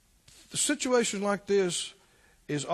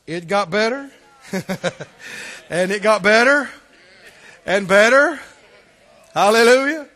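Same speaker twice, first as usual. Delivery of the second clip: joyful; 3 semitones higher, 11 dB louder than usual. Real, not acted. Joyful testimony climax.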